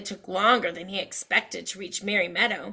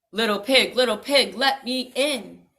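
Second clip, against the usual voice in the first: louder coaxing wolf call; 6 dB louder than usual. Acted. Wolf at the door, loud coaxing call. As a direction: call to the pig through the door.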